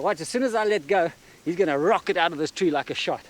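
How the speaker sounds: usual speaking voice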